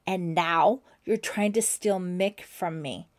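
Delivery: a jealous rival's fury over Mick; distorted with anger. A narrator's reading. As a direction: spit it out in rage. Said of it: "her voice distorted with anger".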